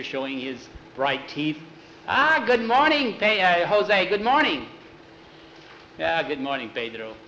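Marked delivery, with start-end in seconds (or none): 2.1-4.7 loud, high-pitched effusive welcome; beaming greeting. A narrator's reading. Pedro comes out grinning to greet Jose, effusively. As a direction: greet him with a huge grin. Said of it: "came out smiling from ear to ear".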